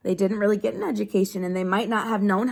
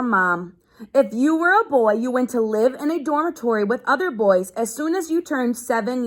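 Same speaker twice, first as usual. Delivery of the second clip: steady read-aloud to a class; reading to children; 4 semitones higher, 3 dB louder than usual. Acted. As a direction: read the page out to the kids. Teacher reads a book aloud.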